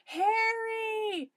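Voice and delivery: high, loud drawn-out call; shouting. A narrator's reading. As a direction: call out for someone missing. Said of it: "we shouted".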